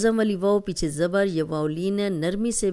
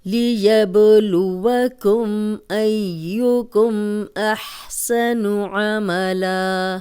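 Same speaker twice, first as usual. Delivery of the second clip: slow, louder melodic tajweed recitation; Quran recitation; 2 semitones higher, 4 dB louder, much slower than usual. Acted. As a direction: chant the verses slowly and fully. (آہستہ، بلند آواز میں ترتیل سے تلاوت)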